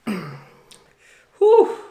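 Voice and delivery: long, high, loud exhale of relief; loud whew. Real, not acted. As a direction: blow out the heat, loud and high. Exhaling after very spicy noodles.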